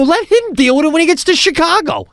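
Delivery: high, exasperated plea; mock-indignant complaint in a high voice; indignant protest. Arguing with the flight attendant.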